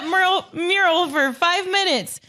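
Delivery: high, laughing mock trash talk; laughing put-on star voice. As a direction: crack up while barking orders at teammates. Joins mocking Luka impression.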